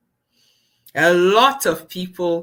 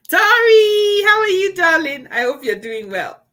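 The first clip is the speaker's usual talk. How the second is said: delighted, high-pitched shout-out; sing-song greeting; 10 dB louder than usual. Real, not acted. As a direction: gush a big hello to a friend. Greets a viewer, calling out.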